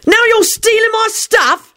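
high, drawn-out accusation; furious, high-pitched protest; angry shout. A narrator's reading. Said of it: "he shouted".

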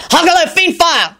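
high, forceful spell cry; bellow. A narrator's reading. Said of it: "Lupin bellowed".

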